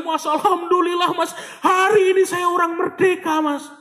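high-pitched imitation of an overjoyed caller; imitated tearful joy. Grateful caller phones the preacher to say he is debt-free, imitated tearful joy. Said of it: "Telepon tuh nangis-nangis".

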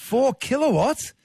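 high, disbelieving question; real shock at the number; surprised. Real, not acted. Caller names huge welder power.

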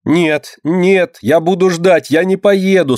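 put-on high-pitched protesting child's cry; tearful cry. A narrator's reading. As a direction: refuse in a high, upset wail. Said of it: "со слезами кричала Динка". (крик сквозь слёзы, высоким голосом)

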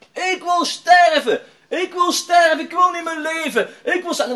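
high, drawn-out hysterical yell; retold desperate shouting. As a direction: act out a young man screaming in despair. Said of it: "die zat keihard te roepen".

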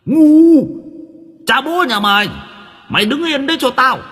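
put-on loud, high, furious old man; furious shout. A narrator's reading. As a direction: bark him to stay put. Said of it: "Ông cụ Túc trợn mắt".

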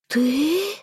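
high stunned gasp of disbelief; hissed shock. A narrator's reading. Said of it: "зашипела я".

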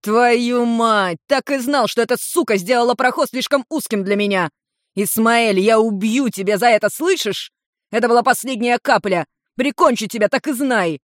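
high, agitated tirade; worked-up, indignant squawking; furious outburst. A narrator's reading. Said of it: "верещал Улмар".

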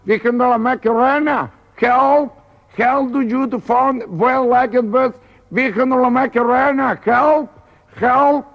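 high-pitched gibberish imitation of English; slow, high mock-English pleading; mock English accent. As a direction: cry for help as a caricature Englishman. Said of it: "El inglés se queda mirando".

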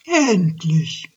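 triumphant call. Acted. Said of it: "rief er und breitete die Arme aus".

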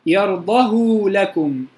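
very slow يرضه with a long u on the ه; ishba' reading. Shown as a demonstration. Teacher shows the last reading, long u on يرضه. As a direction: draw out the u after the ه in يرضه. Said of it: "الوجه الأخير الإشباع".

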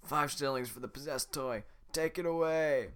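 loud guard voice again right. Acted. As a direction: announce the price. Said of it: "I forgot how to do it".

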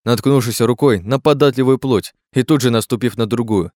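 plain reading voice

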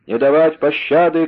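high, loud battle order; shouted order. A narrator's reading. Unseen rider orders no quarter, shouted. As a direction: shout it as a merciless command. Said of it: "крикнул по-французски".

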